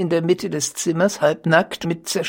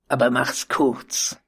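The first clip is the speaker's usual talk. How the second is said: plain reading voice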